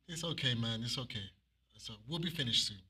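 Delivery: calm them down gently; soothing reply. Retold or imitated. Preacher calming rude people, softly reassuring.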